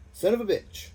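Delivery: a sudden high, loud shout. Acted. Randy shouting at the gloating opponent, furious yell. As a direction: yell it out in fury. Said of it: "and shout".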